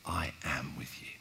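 quiet reassuring promise; hushed promise. Shown as a demonstration. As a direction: breathe it gently.

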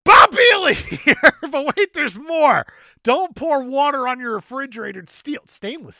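shouted infomercial pitch; Bob Vila as Billy Mays. Acted. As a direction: yell it like a TV pitchman. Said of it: "I'm doing Bob Vila like a Billy Mays".

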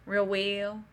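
put-on Southern twang; easy Southern-accented talk. Shown as a demonstration. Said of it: "I can do a real Southern accent".

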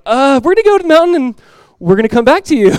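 high, loud, put-on fibbing voice; mock lying. Abraham supposedly fibbing to his men, mock lying. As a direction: stall on a drawn "uh", then fib unconvincingly. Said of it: "like, lying? Just like".

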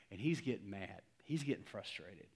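ordinary talk, nothing marked

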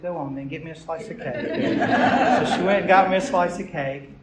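laughing through the words; chuckling as the cake story lands. Real, not acted. Preacher laughing while telling congregation about the cake.

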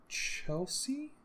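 slow, drawn-out words as you type; typing aloud. Real, not acted.

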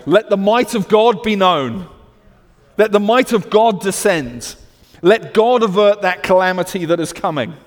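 loud, high declamatory plea; impassioned prayer. Acted. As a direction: cry the prayer out with full conviction. Rousing prayer quoted in sermon.

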